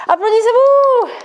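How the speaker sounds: loud, high, slow cheer; celebratory call. Real, not acted. Fitness coach congratulating viewers after the workout, a loud slow cheer. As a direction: make them clap for themselves.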